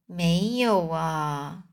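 slow, low, soft and dejected. A narrator's reading. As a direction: let it drop, crushed and worn out. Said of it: "他沮丧地问". (老先生沮丧地说，低沉缓慢)

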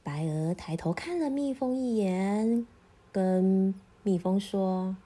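ordinary delivery

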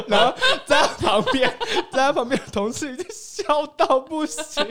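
high, loud laughing narration; shrieking laughter; laughing hard. Real, not acted. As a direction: giggle through the punchline. Retelling the lotus joke.

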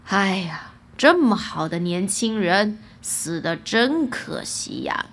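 low, sighing king; sighing regret. A narrator's reading. King thinking the young man dead, sighing with regret. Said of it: "国王叹气道".

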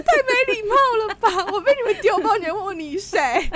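high, loud, laughing outrage; incredulous laughing complaint; laughing indignation. Real, not acted. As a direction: laugh through the disbelief at being asked who you are.

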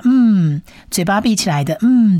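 嗯 hummed as "mm" with lips shut; closed-mouth m. Shown as a demonstration. Teacher shows the final letter, 嗯 as closed-lip "mm". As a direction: hum 嗯 through a closed mouth. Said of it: "嘴巴闭起来的嗯的音".

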